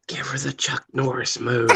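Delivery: soft conspiratorial whisper. Retold or imitated. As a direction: hush it into his ear. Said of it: "whispers to him".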